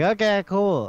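high-pitched, breezy acceptance; mock eager. Friend told to ask the sister out, mock eager. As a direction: agree brightly, as a retold quote.

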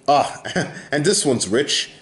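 ordinary delivery

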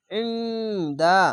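ইংদা held very long with a squeezed throat ain; slow recitation. Shown as a demonstration. Teacher demonstrating throat sound, slow recitation. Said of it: "আইনকে মোটা করে গলা চিপে পড়বেন".

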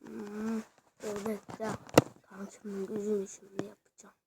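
fast breathless whisper; hushed, quick conspiratorial whisper. Real, not acted. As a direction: whisper the secret plan to the viewers. Secretly plans a special move.

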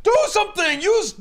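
high, loud panicked demand; yelling at her to act, a touch hammy; frantic boss. Retold or imitated. Arliss yelling at his bodyguard to shoot, loud and high. Arliss voiced urging Yith.

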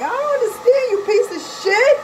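high, loud exasperated snap; furious high yell; angry outburst. Real, not acted. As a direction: blow up at him in disbelief. Venting at ex's stupidity.

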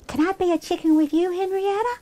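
high, quick pleading question; eager child. Acted. Claire asking to join Henrietta, eager child. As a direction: ask hopefully in a small high voice.

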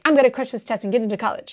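fast, cocky mock student; quick smug student at lightning speed; cocky rushing student. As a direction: rattle it off like an overconfident test taker. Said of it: "and you're like".

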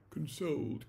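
plain reading voice

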